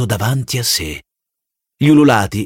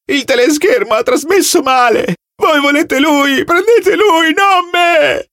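much higher, rising to a shout; hysterical screaming; slower than usual. Acted. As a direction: scream in blind panic.

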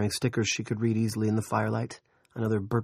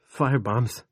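sobbing; 3 semitones higher, 4 dB louder than usual. Acted. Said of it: "he sobbed".

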